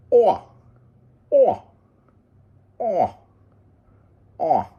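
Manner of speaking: high, loud moose bellows, fading away; moose rut call. Retold or imitated. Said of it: "a bull moose in rut goes".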